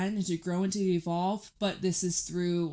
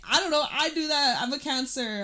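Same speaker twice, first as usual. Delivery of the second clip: excited admission; 7 semitones higher, 4 dB louder than usual. Real, not acted. Confessing she cries when angry.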